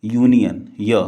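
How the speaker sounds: "यूनियन" opening on a y glide, then "य" alone as ya; union with y onset. Shown as a demonstration. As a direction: begin "यूनियन" with yoo, then isolate "य". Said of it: "इसको क्या pronounce करते हैं".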